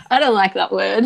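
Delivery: laughing protest. Real, not acted. Guest telling host she dislikes the word, laughing loudly. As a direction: object playfully, still laughing.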